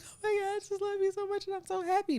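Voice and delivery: high, fast, gushing declaration of love; imagining a wedding-day gush she never said; gushing happy bride. Pretend. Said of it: "I wanted to just be like".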